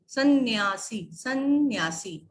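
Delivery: सन्यासी said slowly with a doubled n, sann-yaasi; clear half-n sanyasi. Shown as a demonstration. Teacher demonstrating to pupils, slow careful सन्यासी. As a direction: stretch सन्यासी with a held n before ya. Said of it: "यहाँ पे दिया हुआ है सन्यासी".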